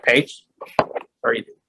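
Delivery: softly, a teasing "what are you doing?"; quiet playful disbelief at her excuse; hushed mock disbelief.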